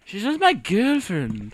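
put-on slow English accent. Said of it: "English accent".

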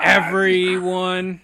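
high, loud, stretched-out villain shout; mock scream. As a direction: echo a hammy movie yell, slowly. Echoing the villain's screamed line.

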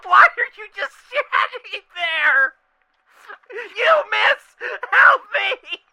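high, laughing panicked shouts; panicked screaming. Acted. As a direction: scream for help while burning. Character burning, screaming.

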